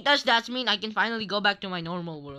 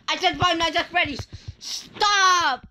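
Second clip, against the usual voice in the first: exasperated insistence; 6 semitones higher than usual. Real, not acted. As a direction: push it through, fed up. Insisting on choice.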